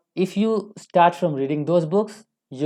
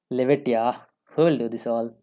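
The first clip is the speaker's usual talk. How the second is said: lower-pitched, dismissive imitation of a quitter; mock giving up; 6 semitones lower than usual. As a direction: voice a learner dropping it, pitch sunk low. Quoting learners who give up.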